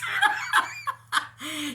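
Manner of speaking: bubbling high laugh; high giggling laugh. Real, not acted. Laughing at the inspector's dirty job. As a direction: let a giggle burst out.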